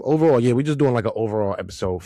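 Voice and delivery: normal talking voice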